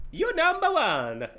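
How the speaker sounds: lightly voiced Mario, high and bright; a light, high stab at Mario; Mario impression. Streamer hamming up Mario for a viewer. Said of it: "That was meant to be my Mario".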